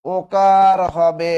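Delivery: ওকার হবে drawn out slowly and loudly; teacher slowly dictating the ওকার rule; slow dictated rule. Shown as a demonstration. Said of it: "কি লিখবেন".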